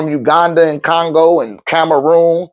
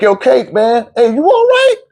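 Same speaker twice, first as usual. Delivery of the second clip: concerned check-in; 6 semitones higher than usual. Retold or imitated. Retold friend checking on crying man.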